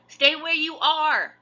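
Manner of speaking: loud, high, slow call to his sister; shouting. A narrator's reading. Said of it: "Jack shouted to her".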